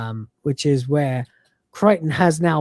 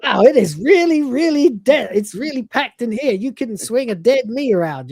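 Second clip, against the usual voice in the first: lightly voiced smug Cat, raised pitch; a breezy mock-sympathy voice, pitched up; 7 semitones higher, 4 dB louder than usual. Retelling Red Dwarf to a friend, voicing Cat mildly.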